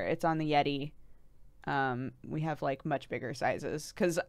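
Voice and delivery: ordinary delivery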